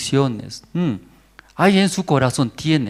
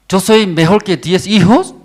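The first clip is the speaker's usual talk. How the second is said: louder, higher boast of the husband; proud husband; 7 semitones higher, 7 dB louder than usual. Acted. Pastor voices husband comforting Ana, proud and loud. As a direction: puff up as the husband comforting his wife.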